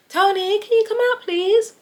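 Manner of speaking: high, sing-song polite call through the door; sweet nan calling. Retold or imitated. Nan knocking on Tony's door, high sing-song call. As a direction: coax him out of the room, all sugar.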